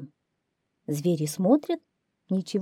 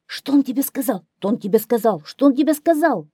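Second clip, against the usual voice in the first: higher, clamouring crowd of animals; animals all at once; 6 semitones higher, 5 dB louder, faster than usual. A narrator's reading. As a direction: fire it off as several excited animals. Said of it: "заговорили все звери сразу". (звери галдят наперебой)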